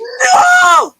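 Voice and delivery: high, loud emphatic no; loud shouted no. Real, not acted. Emphatic denial about bag.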